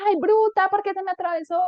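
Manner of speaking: high-pitched road-rage outburst; exaggerated indignant cry; mock angry driver. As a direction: lash out as a cut-off driver. Acting out angry driver yelling.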